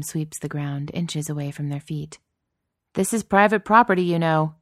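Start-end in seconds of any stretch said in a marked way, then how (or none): 2.9-4.6 guard shouting. A narrator's reading.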